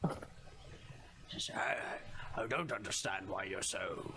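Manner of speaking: low, hushed mystic voice; fortune teller. Acted. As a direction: lean in like a shady seer. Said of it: "old guy who is masquerading as a, as a fortune teller".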